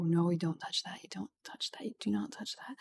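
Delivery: hushed, firm whisper telling the cat off; whispered scolding. Real, not acted. Whispering sternly at the cat pawing the mic. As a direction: scold him under your breath so the mic barely hears.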